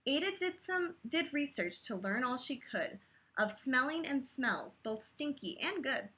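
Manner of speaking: rhythmic read-aloud of a rhyming book; lilting bedtime-story voice; story narration. A narrator's reading. Reading a picture book aloud.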